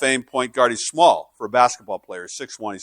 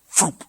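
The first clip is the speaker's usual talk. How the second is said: quick mock whoosh of a shotgun coming out; gun whoosh sound; 6 semitones higher than usual. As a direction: snap out a little swoosh noise. Shotgun pulled out.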